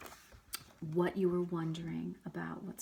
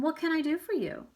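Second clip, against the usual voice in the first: friendly greeting teacher; 9 semitones higher, 6 dB louder than usual. Acted. Mister E greets visitors.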